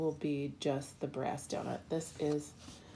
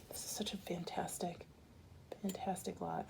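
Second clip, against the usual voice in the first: soft, awed whisper, much softer; 12 dB quieter than usual. Real, not acted. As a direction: breathe it out in hushed awe. Admiring a bead lot.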